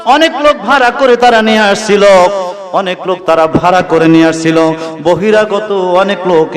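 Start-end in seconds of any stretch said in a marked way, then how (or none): 0.1-2.0 high, chanted sermon cry; fervent high-pitched appeal to the congregation; impassioned preaching. Real, not acted. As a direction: ring it out high, then gradually descend.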